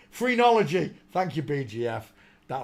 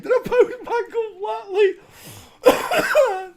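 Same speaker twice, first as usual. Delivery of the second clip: laughing; 8 dB louder than usual. Real, not acted. Laughing at own post.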